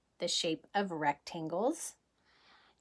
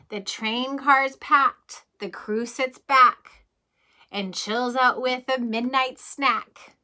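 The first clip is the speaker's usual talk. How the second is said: lively rhyming read-aloud, a little louder; storybook reading; 4 semitones higher, 7 dB louder, slower than usual. Acted. Reading a bedtime train book to children, lively read-aloud. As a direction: bounce through the rhyme for the kids.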